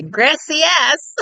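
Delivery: a hammed-up tourist gracias; silly mock Spanish. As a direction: imitate someone butchering Spanish for fun. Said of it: "being silly and stupid".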